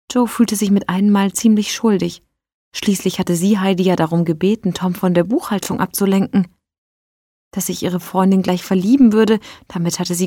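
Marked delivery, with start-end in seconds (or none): none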